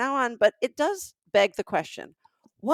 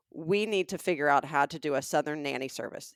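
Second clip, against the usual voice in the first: usual speaking voice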